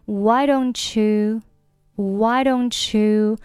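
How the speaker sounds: "don't you" said as don-chew, slow; slow linking drill. Shown as a demonstration. Teacher drilling don't you as chew. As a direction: blend t and y into ch. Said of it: "don't和you连读成".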